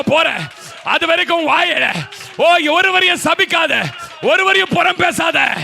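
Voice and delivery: shouted high prophetic preaching with tongues; preaching at full force. Acted. Pastor shouting prophecy over the congregation in full force. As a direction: cry out the prophecy over the church.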